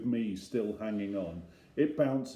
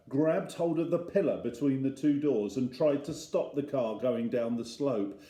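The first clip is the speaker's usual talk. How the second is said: ordinary delivery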